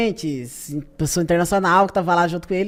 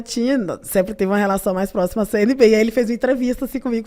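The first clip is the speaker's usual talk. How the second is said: normal talking voice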